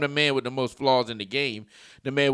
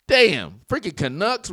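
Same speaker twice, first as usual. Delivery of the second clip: normal talking voice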